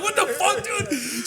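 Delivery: high-pitched what the fuck; stunned disbelief. Retold or imitated. Thinking at the tow truck driver, shocked high squeak. Inner voice reacting, retold.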